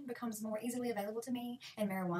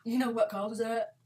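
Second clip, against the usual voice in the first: snide relative; 8 dB louder, slower than usual. Retold or imitated. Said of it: "It's the".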